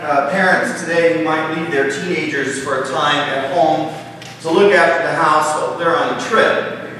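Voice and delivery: ordinary talk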